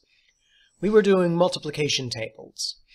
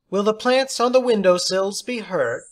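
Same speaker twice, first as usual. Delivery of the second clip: high, louder teacher's voice; theatrical teacher; 7 semitones higher, 6 dB louder than usual. Acted. Substitute teacher praising John, high and theatrical. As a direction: play the odd substitute, grand and pleased.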